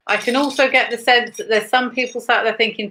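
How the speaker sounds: normal talking voice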